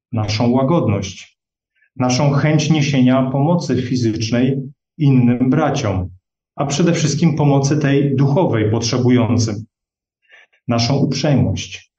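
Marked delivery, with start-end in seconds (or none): none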